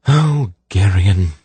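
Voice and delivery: slow, drawn-out weary reproach; long-suffering, exasperated sigh; resigned sigh. A narrator's reading. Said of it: "she sighed in resignation".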